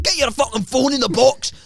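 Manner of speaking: bossy Scottish supervisor; comic Scottish command; mock Scottish accent. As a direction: put on a Scottish accent and order them about. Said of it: "Exactly like that".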